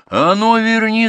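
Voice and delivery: high, loud yell after someone; strained high-pitched holler; shouting. A narrator's reading. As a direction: shout a furious order at a distance. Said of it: "закричал шофёр".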